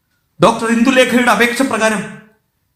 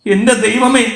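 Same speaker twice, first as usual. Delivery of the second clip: ordinary delivery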